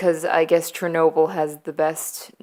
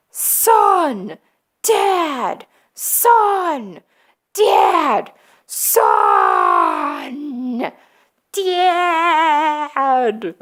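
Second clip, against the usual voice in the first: hammed-up, drawn-out high yells; mock shouting match; 8 semitones higher, 5 dB louder, much slower than usual. Father and son yelling at each other, hammed-up shouts. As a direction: bellow each word longer, trading back and forth.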